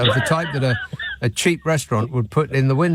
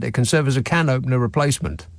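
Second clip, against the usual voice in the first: ordinary talk, nothing marked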